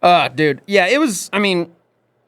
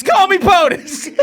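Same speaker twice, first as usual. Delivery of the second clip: slow, high, laughing plea; hammed bedroom voice; 3 dB louder, slower than usual. Joking about a bedroom request, slow laughing plea. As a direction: drag it out, hamming it up.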